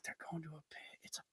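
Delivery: barely audible muttered correction; acted-out whisper. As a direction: whisper retorts so she can't hear, playfully. Muttered retorts about overheard lady.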